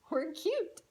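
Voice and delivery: ordinary delivery, nothing marked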